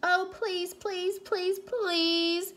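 high, drawn-out pleading; high-pitched child's begging. A narrator's reading. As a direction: plead like a little girl who really wants it. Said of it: "begged Cassie".

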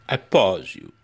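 clickbait video narrator; hyped-up host sign-off; YouTuber voice. Pretend. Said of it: "my YouTuber voice".

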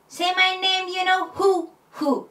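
"Say" and "who" accented, slightly harder; stress on say and who. Shown as a demonstration. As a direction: punch "Say" and "who" for groove. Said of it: "若干アクセントを強く". (「Say」と「who」にアクセントを強く)

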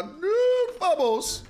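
sudden high triumphant shout; excited cheer. Real, not acted. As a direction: cheer it, big win. Cheering a win in game.

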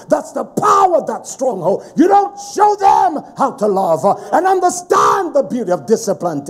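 loud, high, rolling sermon cadence; preaching at full force. Acted. Preacher rebuking the congregation, preaching at full force. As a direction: thunder it from the pulpit with a sing-song lift. Preaches against worldly ways.

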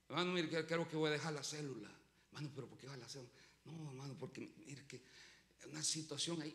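low, very soft voice; hushed, low mumbled excuses; mock reluctant excuse. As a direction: imitate someone sheepishly backing out. Acts out leader making excuses.

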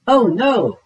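high startled "oh no"; sudden worried cry; alarmed cry. A narrator's reading. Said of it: "cried Boots".

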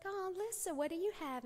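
high, soft and gentle; soft mother to her toddler; tender mother. Retold or imitated. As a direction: coax it out of a little child.

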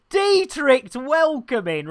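high, sing-out welcome call; bright-pitched shout-out to a newcomer; greeting a viewer. Real, not acted. Greets arriving viewer by name.

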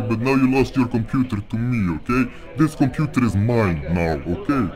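processed voice-changer voice. Real, not acted. Hacker confronting a scammer, voice changer. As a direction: speak through a voice changer. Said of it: "get off that voice changer".

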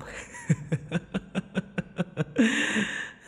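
a long, airy, delighted giggle; gleeful giggle. Acted. Vampire giggling over his captive's threat, gleeful. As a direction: giggle like the cruelty is a game.

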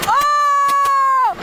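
high startled gasp of a word; sudden high yelp; startled cry. Real, not acted. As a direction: jolt of real surprise. Ride lurches into motion.

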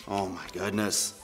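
low, slow, soft groan of words; weary dismay. Acted. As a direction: sigh it out, resigned. Dismayed at having to continue.

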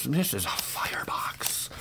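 secretive whisper. Acted. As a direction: lean in and whisper the reveal. Whispered reveal of the box.

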